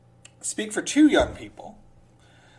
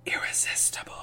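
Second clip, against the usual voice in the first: whisper; 5 dB quieter, faster than usual. Acted. Whispered end of quote.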